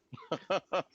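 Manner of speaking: high-pitched amused laugh. Real, not acted. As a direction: laugh at a silly misunderstanding. Laughs at the misunderstanding.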